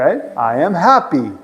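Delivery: ordinary talk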